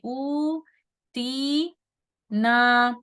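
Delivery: "ऊ", "ती", "ना" drawn out with pauses between; two counts each. Shown as a demonstration. Teacher demonstrating two harakat on each, long held syllables. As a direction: sustain "ऊ", "ती", "ना" evenly, two beats apiece. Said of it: "दो दो हरकत करेंगे".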